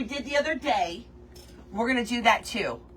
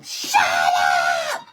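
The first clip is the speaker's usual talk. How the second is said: full-throated, harsh scream; sudden loud, drawn-out screech; 7 dB louder than usual. Shown as a demonstration. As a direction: scream it like a crazy person. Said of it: "I just scream".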